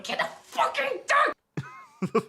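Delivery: hammed-up exasperated cry; mock outburst. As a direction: wail it in disbelief. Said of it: "retold outburst".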